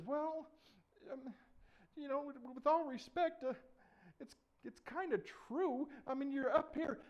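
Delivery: put-on high, halting boy's voice; nervy, high, apologetic hedging; hesitant David. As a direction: stammer politely before a king. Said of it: "And David says".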